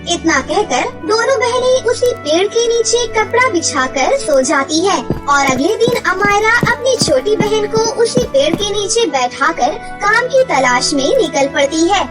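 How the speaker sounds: usual speaking voice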